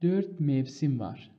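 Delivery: extremely slow, syllable by syllable; slow dictation. Shown as a demonstration. Teacher to students learning the colon, very slow dictation. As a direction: draw each word out for the class to copy. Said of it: "مثلاً".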